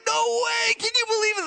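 amazed shout. Retold or imitated. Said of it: "And everybody's like".

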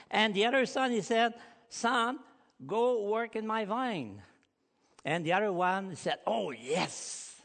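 loud, high, fervent sermon voice; preaching at full force. Real, not acted. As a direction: drive the story home to the congregation. Preaching the Jonah and sons story.

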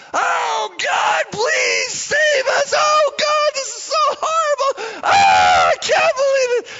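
anguished, high-pitched full-force wail; Mordecai yelling. Acted. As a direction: cry out to God at the top of your voice. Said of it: "He's actually yelling in the streets".

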